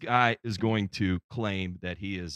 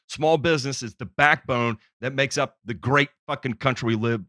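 normal talking voice

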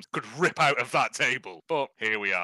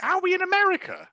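much higher, mock-baffled question; incredulous. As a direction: squeak it out in disbelief. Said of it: "and I'm like".

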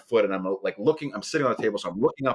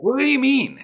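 much higher, louder incredulous question; retold disbelief; 7 dB louder than usual. Patient to doctor who said he'd hurt him, high incredulous question. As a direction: pitch it way up in shocked disbelief.

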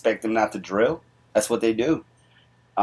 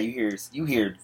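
ordinary talk

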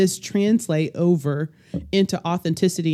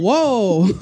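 surprised laugh; 4 semitones higher, 7 dB louder than usual. Retold or imitated. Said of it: "You'd be like".

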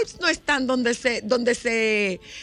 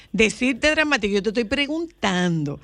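usual speaking voice

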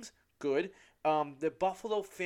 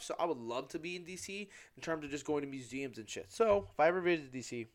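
usual speaking voice

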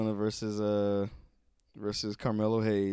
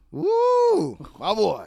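high loud cheer; excited woo; 10 semitones higher, 9 dB louder, much slower than usual. Real, not acted. Fan greeting her favorite's return with a high cheer. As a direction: whoop for the returning star.

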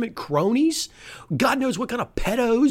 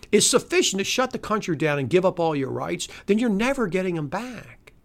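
ordinary delivery

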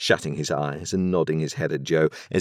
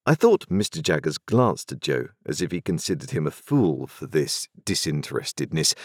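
usual speaking voice

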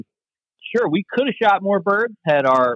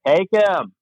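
higher-pitched mock call-out; retold shout; 7 semitones higher than usual. Guide calling to novice hunter from the boat, retold shout. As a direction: imitate a shout held well below full volume. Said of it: "I finally just shouted".

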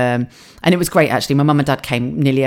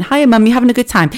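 raised, higher voice calling down the phone; loud cheery phone greeting; 7 semitones higher, 4 dB louder than usual. Retold or imitated. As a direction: call out so a deaf mum can hear. Calling to deaf mum on FaceTime.

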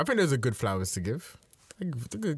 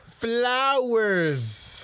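excited announcement; 8 semitones higher, 5 dB louder than usual. Acted. Announcing the flowers segment.

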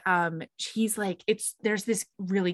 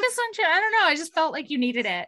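higher, lightly voiced maid; voicing the maid; 8 semitones higher, faster than usual. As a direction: offer it innocently, pitched up.

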